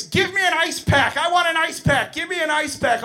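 put-on high-pitched demanding child; screaming little girl. Little girl demanding ice pack, high screaming. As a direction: yell it right in the teacher's face. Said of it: "Little girl screaming in my face".

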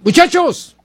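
high-pitched hail to the lads; calling out. Retold or imitated. Retelling how bar regulars greeted the band, calling out. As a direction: imitate a regular hailing the group.

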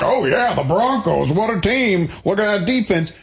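fake football fan. Acting someone larping at football talk, fake fan enthusiasm. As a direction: bluff like you know the team. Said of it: "You're larping being a football fan".

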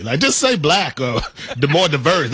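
normal talking voice

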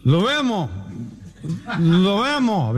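put-on high-pitched drunk goodbye; hammed-up drunken slur; slurring drunk. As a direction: say goodbye too drunk to form the words. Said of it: "negro no puede ni hablar".

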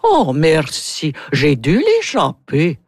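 slow Russian-accented princess. Shown as a demonstration. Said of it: "Natalia va dire".

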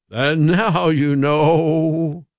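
hammed-up, drawn-out broadcaster sign-off; slow, grandiose announcer parody; mock radio storyteller. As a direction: stretch every word like an old radio catchphrase.

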